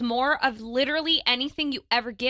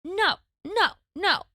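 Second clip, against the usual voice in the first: put-on higher stubborn toddler no; toddler refusing; 8 semitones higher, 4 dB louder, slower than usual. Son refusing mom's suggestion, stubborn toddler no. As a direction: mimic a child's firm refusal. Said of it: "what about this?".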